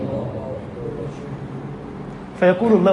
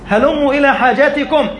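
high, ringing call with a laugh in it; delighted summons, voice up high; joyful call; 7 semitones higher than usual. Acted. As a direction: call the angels' summons out gladly, much higher. Preacher repeats the angels' call.

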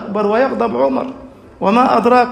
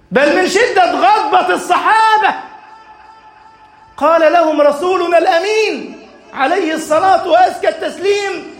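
an impassioned sermon climax; fiery preaching; 6 semitones higher than usual. Acted. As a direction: drive the lesson home at full force. Preaching at full force.

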